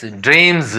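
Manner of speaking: ड्रीम्स ending in a buzzed z; voiced Z ending. Shown as a demonstration. Teacher reads the spelled word, ड्रीम्स with final z. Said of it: "ड्रीम्स नहीं पढ़ा जाएगा".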